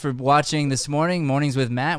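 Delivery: cheerful host thanking the audience; show host outro. Acted.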